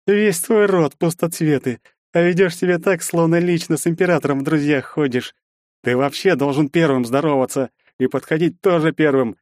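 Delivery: high, contemptuous dressing-down of an inferior; haughty scolding. A narrator's reading. Stranger lecturing the hero on manners, scornful and mocking. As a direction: scold him from above, pitched high.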